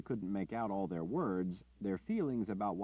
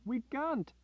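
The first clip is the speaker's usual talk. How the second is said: timid villager; 4 dB louder, slower than usual. Acted. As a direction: plead gently against violence. Peaceful villagers refuse Richard.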